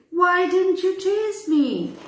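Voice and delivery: high, hesitant timid question. Acted. Boy timidly asks the lion again, meekly. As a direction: ask it meekly, a scared boy. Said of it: "he asked timidly".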